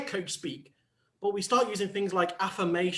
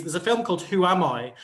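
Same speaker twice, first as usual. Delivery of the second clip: ordinary talk, nothing marked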